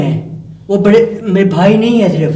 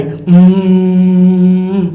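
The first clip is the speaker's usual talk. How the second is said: drawn-out hum; much slower than usual. Retold or imitated. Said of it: "پھر آواز آئی".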